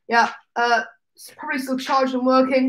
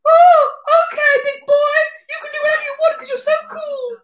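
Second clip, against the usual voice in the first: much higher, fast, sing-song mock; mocking sister; 14 semitones higher, 4 dB louder than usual. Sister humouring her little brother, high and fast. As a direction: squeak it out like a patronising big sister. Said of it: "and she's like".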